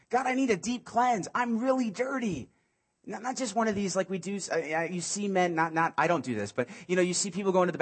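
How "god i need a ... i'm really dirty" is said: pleading cry. Acted. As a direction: beg God with rising force. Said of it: "this is what David's saying".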